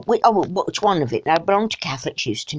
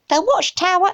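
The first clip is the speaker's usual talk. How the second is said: higher-pitched mock quoting voice; pompous high-pitched read-out; put-on reader voice; 10 semitones higher than usual.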